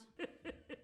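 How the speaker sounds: soft voiceless chuckle; a hushed little laugh to yourself; quiet laugh. Acted. Laughing at a funny sight.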